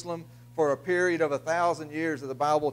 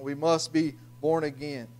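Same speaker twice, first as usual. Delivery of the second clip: usual speaking voice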